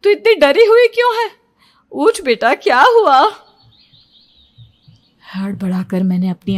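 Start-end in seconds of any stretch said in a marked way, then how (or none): 0.0-3.3 worried mother. Acted. Mother waking frightened daughter.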